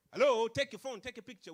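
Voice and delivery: loud hello to the audience; loud call. Real, not acted. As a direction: call out to the room. Calling the audience to act.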